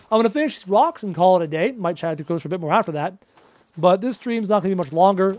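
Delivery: normal talking voice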